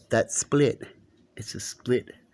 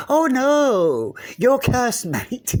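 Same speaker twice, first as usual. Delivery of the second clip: much higher, louder cry of oh no; hammed-up dismay at the cursed mate; mock alarm; 13 semitones higher, 6 dB louder, much slower than usual. Calling out as they got over the fence, mock alarmed. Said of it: "as I went".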